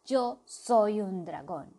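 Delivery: slow, emphatic claim; slow insistence. Acted. The small bird insisting slowly he is a dragon. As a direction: lay out each word slowly.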